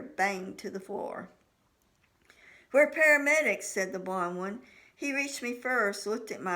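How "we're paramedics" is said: calling out. A narrator's reading. Paramedic calls out in emergency.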